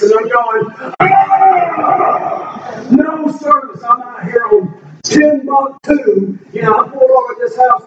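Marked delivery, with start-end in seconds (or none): 1.0-3.8 high frustrated outburst; exasperated cry. Retold or imitated. Driver losing it at his dead phone, high exasperated cry. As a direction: throw up your hands at the phone.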